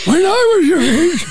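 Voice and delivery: high, laughing mock old-timer voice; booming tipsy uncle. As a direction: tease like a rambling old relative. Teasing the host about her age.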